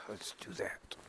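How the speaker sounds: soft whispered aside; whispering. Real, not acted. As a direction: drop to a whisper. Quiet aside while setting up.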